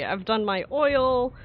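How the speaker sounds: usual speaking voice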